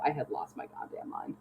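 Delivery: hushed, unvoiced confession; whispered. Telling her friend how fed up she was, whispered. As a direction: breathe it out under your breath.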